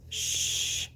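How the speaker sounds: soft breathless shush; hushing. A narrator's reading. Mom soothing her crying son, soft unvoiced shush. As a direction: gently shush a crying child. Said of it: "she said".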